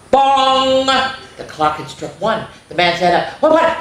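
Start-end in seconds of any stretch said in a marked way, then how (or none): 0.1-0.9 loud high bong; clock bong. A narrator's reading. Narrator sounds the clock striking one. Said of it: "clock strikes one". 3.4-3.8 high startled yelp; startled what. Acted. Man startled awake by the clock, high yelp.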